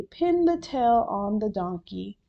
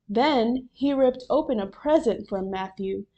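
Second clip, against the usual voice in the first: plain reading voice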